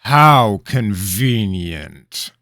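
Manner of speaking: very slow, loud sarcastic remark; king doubting the boy's tale, dark sarcasm; darkly. A narrator's reading. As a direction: let the suspicion drip. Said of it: "he said darkly".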